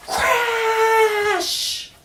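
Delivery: high loud shouted crash. A narrator's reading. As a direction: belt out the crash like things breaking. Ear-splitting crash in story.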